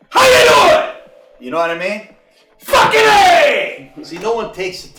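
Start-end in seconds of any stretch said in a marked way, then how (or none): 0.1-0.9 very high, loud sudden holler; exaggerated inflection. Shown as a demonstration. Coach demonstrating voice inflection, high holler. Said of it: "Voice inflection, like". 2.6-3.9 high, drawn-out yell; exaggerated inflection. Shown as a demonstration. Coach showing inflection, long high yell. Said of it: "Voice inflection".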